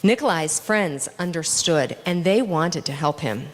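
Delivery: plain reading voice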